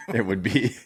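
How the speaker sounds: high burst of laughter; laughing. Real, not acted. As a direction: laugh through the words. Laughing at the joke.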